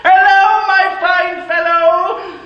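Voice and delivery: calling out. Acted. Calling down to boy in street.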